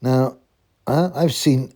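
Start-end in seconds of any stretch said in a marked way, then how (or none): none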